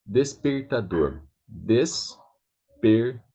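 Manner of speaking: despertador slowly, broken up. Shown as a demonstration. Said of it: "Trying to speak that and then put out together".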